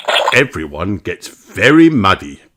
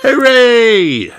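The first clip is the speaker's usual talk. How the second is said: high happy cheer; 7 dB louder than usual. A narrator's reading. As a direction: cheer like a crowd of kids. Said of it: "Everyone cheers".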